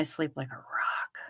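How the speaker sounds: unvoiced whispered aside. Real, not acted.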